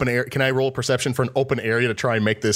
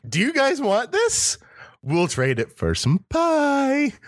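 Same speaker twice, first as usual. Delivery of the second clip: put-on drunk bargaining; slower than usual.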